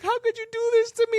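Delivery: high-pitched mock wail; imitated crying mom. Mom on the phone to arrested son, imitated crying. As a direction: wail it like a heartbroken mother, hammed up. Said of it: "and she was crying".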